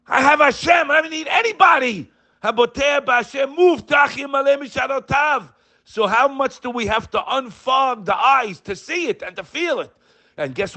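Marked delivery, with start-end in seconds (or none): 0.1-5.5 impassioned preacher shouting his faith; fiery preaching. Acted. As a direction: preach it at full force, rising to a shout. Preaching at full force.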